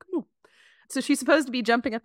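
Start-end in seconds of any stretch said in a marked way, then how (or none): 0.0-0.2 high, funny mascot voice; comic put-on character voice; Buster Scruffs. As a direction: do a silly, higher-pitched mascot coaxing a kid. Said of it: "he's got the funniest voice".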